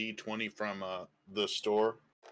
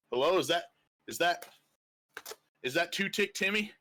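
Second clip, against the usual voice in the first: calling out; 8 semitones higher than usual. Acted. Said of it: "he wakes up going".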